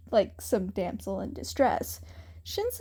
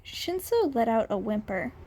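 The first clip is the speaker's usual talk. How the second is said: plain reading voice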